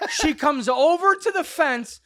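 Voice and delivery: ordinary talk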